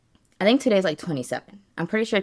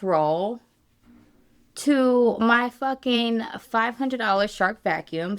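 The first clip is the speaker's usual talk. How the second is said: exasperated; 6 semitones higher, 5 dB louder than usual. Real, not acted. Dog chewed up vacuum.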